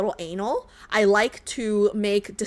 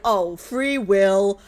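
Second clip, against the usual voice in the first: slow, sneering mock of their excuse; mocking quote; 3 semitones lower, much slower than usual. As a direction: drawl it out like a flimsy excuse. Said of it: "It's like".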